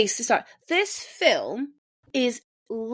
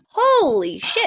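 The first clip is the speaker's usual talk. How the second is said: shocked exclamation; 7 semitones higher, 4 dB louder, slower than usual. Retold or imitated. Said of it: "I was like".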